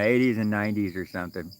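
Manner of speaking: usual speaking voice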